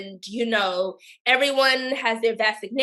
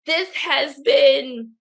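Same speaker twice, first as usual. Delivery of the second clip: ordinary delivery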